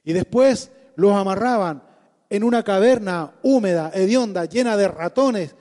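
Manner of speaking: impassioned loud sermon delivery; preaching at full force. Acted. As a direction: preach it hard and fervent. (predicación apasionada a toda voz)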